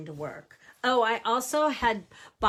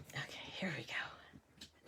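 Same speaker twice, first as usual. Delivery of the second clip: whispering; 5 semitones lower, 15 dB quieter, slower than usual. Real, not acted. Careful moment before reveal.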